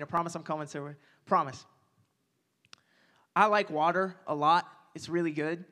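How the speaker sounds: usual speaking voice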